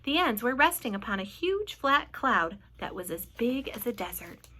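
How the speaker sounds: ordinary delivery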